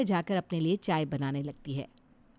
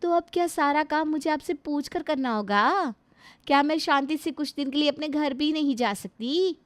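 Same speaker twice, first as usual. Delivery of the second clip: higher, indignant questions; defiant retort; 6 semitones higher than usual. Acted. Daughter-in-law snapping back at mother-in-law about visiting her parents. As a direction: push back hard.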